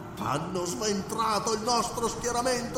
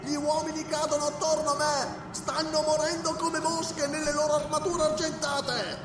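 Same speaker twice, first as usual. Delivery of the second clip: wailing priest; 14 semitones higher, 5 dB quieter than usual. Acted. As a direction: wail out the ruin, half singing. Wounded priest wails.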